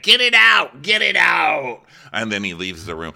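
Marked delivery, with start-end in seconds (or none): 0.0-1.8 much higher and louder, yelling; frantic shout. Retold or imitated. Host as Gary yelling at Ashley to stop, frantic shout. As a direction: scream it out in panic. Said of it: "He's like".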